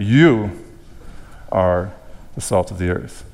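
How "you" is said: "you" loud and rising, held before a pause; emphatic YOU. Shown as a demonstration. Preacher showing whom Jesus emphasizes, emphatic "you". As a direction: hit "you" hard and stop. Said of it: "Jesus is emphasizing the fact that".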